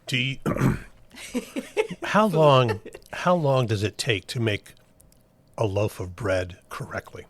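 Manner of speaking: talking through a bite; words round a mouthful; mouth full. Real, not acted. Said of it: "[name]'s mouth is full".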